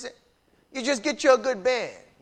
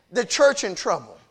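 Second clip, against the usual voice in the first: usual speaking voice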